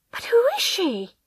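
high-pitched anguished question; desperate question. A narrator's reading. Wife desperately questions husband.

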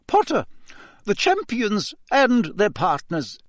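high, clipped Scottish schoolmistress; a strict old teacher addressing the class; stern McGonagall. Acted. Teacher announcing the ball to the class, stern and high.

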